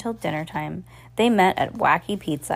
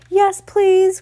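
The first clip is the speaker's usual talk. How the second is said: high, loud eager yes please; excited cry; 13 semitones higher, 7 dB louder than usual. A narrator's reading. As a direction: squeal it with delight. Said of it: "cried the girls".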